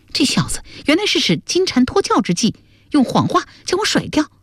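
high-pitched indignant character voice; resentful anger. Acted. Duan Sengnu realises she was tricked, resentful and high-pitched. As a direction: fume at having been tricked, without raising the volume. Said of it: "段僧奴大怒， 恨恨地说".

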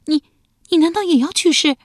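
wife terrified her husband will die; frightened. A narrator's reading. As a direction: blurt it out in alarm. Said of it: "瞪着一双惊恐的眼睛问道".

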